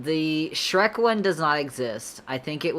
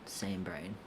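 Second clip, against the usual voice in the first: soft unvoiced whisper of the words; 5 semitones lower, 12 dB quieter than usual. Real, not acted. Streamer agreeing with chat, then whispering to herself. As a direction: breathe it to yourself.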